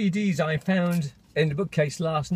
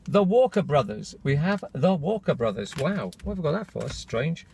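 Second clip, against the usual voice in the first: normal talking voice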